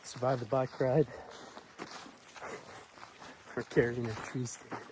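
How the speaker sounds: out of breath after biking; gasping for air. Real, not acted. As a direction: still winded, catching breath. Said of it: "Sorry, I'm out of breath".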